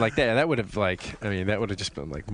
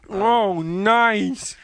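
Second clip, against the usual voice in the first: high, loud put-on approval; hammed-up leering reaction; game master role; 10 semitones higher, 5 dB louder than usual. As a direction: squeal it in mock appreciation. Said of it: "I could be the G M".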